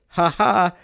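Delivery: imitating someone's awkward laughter; imitated laugh. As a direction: fake a laugh as two loud syllables. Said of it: "And she started laughing".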